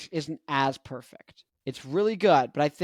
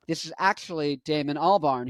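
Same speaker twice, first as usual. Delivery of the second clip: normal talking voice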